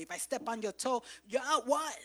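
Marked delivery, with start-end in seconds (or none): 1.3-2.0 loud, affronted yelp; mock indignant. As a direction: bristle at a stepped-on toe. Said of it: "You get real ugly".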